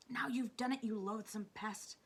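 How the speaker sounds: plain reading voice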